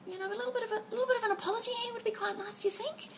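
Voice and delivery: high-pitched, soft parental hint; sweetly hinting. Retold or imitated. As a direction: prompt the child lightly and delicately. Retells gently prompting child for apology.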